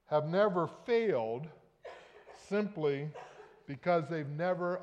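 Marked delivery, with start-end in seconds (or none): none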